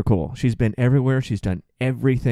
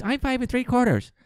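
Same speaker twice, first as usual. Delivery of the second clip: put-on little kid voice; 14 semitones higher than usual. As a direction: squeak it like a proud little kid. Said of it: "Like little kids".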